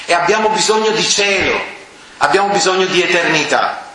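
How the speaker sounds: rising sermon fervour, pitch held high; fervent preaching. Acted. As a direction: press the crowd to look to heaven.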